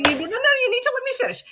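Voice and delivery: high, fast protest to be heard; urgent plea to let her finish; insistent interruption. Real, not acted. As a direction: cut in fast, pitch up high to hold the floor.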